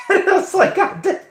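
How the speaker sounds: high squealed laugh through the words. Real, not acted. Streamer losing it over a retold audition insult, laughing.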